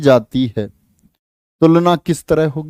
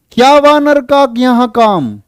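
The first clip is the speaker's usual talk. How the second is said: high, slow mocking question; Radha teasing; 8 semitones higher, 4 dB louder, slower than usual. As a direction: tease him as Radha.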